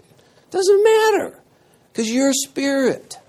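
high, mocking voice of their view; high, scornful parody of the Corinthian view. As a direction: parody the careless Corinthian, rising.

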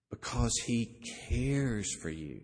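"cares" stretched slowly after a pause; pause, then dwell on "cares"; slow, pondering weight on "cares"; stress on CARES. Shown as a demonstration. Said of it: "you start emphasizing different words".

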